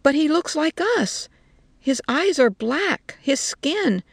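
put-on old woman protesting in astonishment to soldiers; astonished. A narrator's reading. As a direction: she can't believe what they say. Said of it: "in the greatest astonishment".

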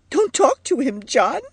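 high, upset woman's voice; distressed wife urging her husband; insistent. A narrator's reading. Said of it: "insisted Edith".

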